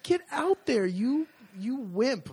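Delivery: high, drawn-out goading of a wimp; exasperated teasing scold; mock scolding. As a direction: egg them on, stretching out the words. Mock scolding of timid hunters.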